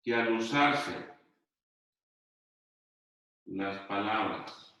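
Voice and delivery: dictating slowly, word by word; slow dictation. Shown as a demonstration. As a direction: spell it out for students copying it down. Teacher dictating to class. (dictado lento, palabra por palabra)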